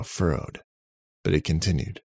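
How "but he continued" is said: ordinary delivery, nothing marked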